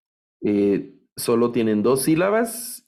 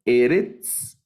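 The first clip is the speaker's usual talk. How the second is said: Eretz said E-retz, first syllable stressed; 3 semitones higher, 3 dB louder than usual. Shown as a demonstration. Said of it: "esta la debo leer". (Eretz acentuado en la primera sílaba)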